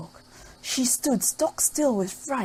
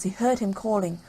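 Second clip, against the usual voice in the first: ordinary delivery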